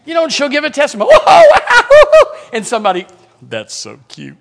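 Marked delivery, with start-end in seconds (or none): 1.1-2.5 very high, loud mock whoops; imitated excited whooping. Preacher mimicking a woman's testimony, excited whooping. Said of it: "she gets all excited". 3.4-4.4 very soft, low imitation of an onlooker; hushed mock reaction. Somebody reacting to her testimony, imitated low and hushed.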